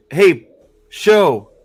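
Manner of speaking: loud, high, slowed yell at the show; hammed-up exasperated shout; mock yell at show. Fan yelling at the show's writers, exasperated mock shout. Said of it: "it's like".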